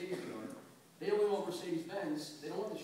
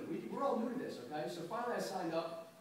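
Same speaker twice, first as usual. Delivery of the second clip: ordinary talk, nothing marked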